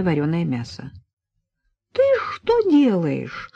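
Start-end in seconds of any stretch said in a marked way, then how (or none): 1.9-3.5 high, slow, aghast question; shocked gasp. A narrator's reading. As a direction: catch someone in the act, aghast. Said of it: "ахнула не кстати обернувшаяся Надя". (ахнув от ужаса)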